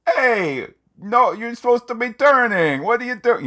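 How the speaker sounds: high, loud mock yelling at a driver; acted indignant shout. Imagined bystander yelling at a driver to turn, mock indignant shout. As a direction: holler it like an exasperated onlooker.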